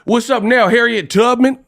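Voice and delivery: high, challenging gangster call; mock Compton gangster. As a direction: parody a Compton tough guy picking a fight. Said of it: "there'd be some Compton gangsters".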